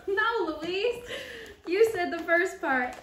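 loud, high-pitched correction; amused protest. Acted. Friend setting Louise straight, loudly amused.

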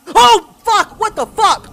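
startled shout of fear; scared yell. Real, not acted. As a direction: yell out in fright. Jump scare in horror game.

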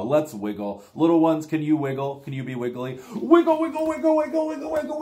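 ordinary delivery

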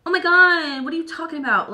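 high, loud imitation of playing the victim; hammed-up innocent protest; mock victim. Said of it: "they're gonna play victim automatically".

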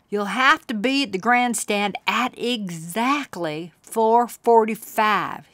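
a hint of an old cowboy, slowing on the time; lightly voiced cowboy. Uncle setting the kids a deadline, lightly cowboy-voiced. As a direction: lean on the time like a folksy cowboy. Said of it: "he said".

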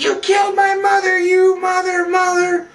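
very high wailing shout; mock grief-stricken fury. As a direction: screech the accusation, hammed-up. Butch accuses the killer.

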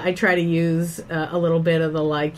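ordinary delivery

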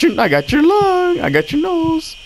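very high sing-song taunt; playful teasing. Pretend. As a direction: tease as if playing got-your-nose. Teasing got-your-nose sing-song.

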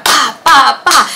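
mock phone-smashing bangs; imitated smashing noises. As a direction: punch out each smash sound.

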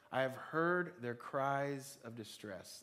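slow, deliberate, each word weighed; slow emphatic reading. Shown as a demonstration. Preacher slowly reading a verse for the congregation. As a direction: read it slowly for them to repeat. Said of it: "Let's say that together".

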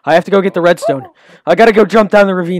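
loud, thrilled and eager; excited shout. Real, not acted. Excited about redstone find.

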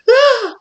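high loud shriek; mock scream. Terrified attacker to the client, high loud shriek. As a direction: shriek in fright. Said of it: "this person's just like".

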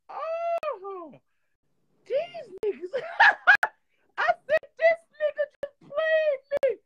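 high, loud shocked cry of disbelief; shocked outrage. Retold or imitated. Retelling being passed over, a loud shocked squeal. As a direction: squeal it like you've just been betrayed.

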